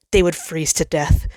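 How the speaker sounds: ordinary delivery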